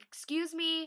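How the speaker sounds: calling out high and loud to get his attention; high, loud excuse me. Retold or imitated. Fan grabbing the singer's shoulder, high loud call. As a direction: pitch it up and call out to catch him. Gripping [name]'s shoulder to stop him.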